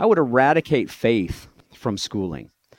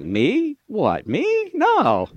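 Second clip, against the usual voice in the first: higher, rising "what, me" of fake innocence; mock innocence; 8 semitones higher, much slower than usual. Pretending innocence to a literal listener, mock innocent. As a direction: play dumb, all wide-eyed denial. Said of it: "continue to be sarcastic".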